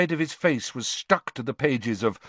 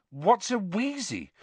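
confused; 8 semitones higher, 3 dB quieter than usual. Acted. Harry confused.

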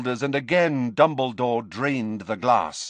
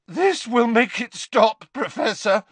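high, strained reassurance; a hint of a cracking voice on a raised pitch; Harry, voice cracking; 8 semitones higher, 4 dB louder than usual. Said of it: "his voice cracking as he tipped".

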